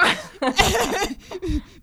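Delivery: loud, high burst of laughing; loud laughter. Real, not acted. Laughing at her own joke about the husband, loud. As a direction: laugh out hard.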